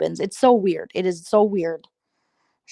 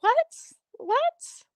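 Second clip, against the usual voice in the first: much higher, squeaky disbelieving what; shocked what; 3 dB quieter than usual. Retold or imitated. Reacting to the rooster story, high pitched shock. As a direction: shriek it in mock disbelief.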